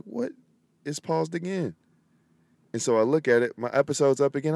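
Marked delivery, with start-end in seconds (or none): 0.0-1.8 annoyed surprise. Retold or imitated. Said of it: "I was like".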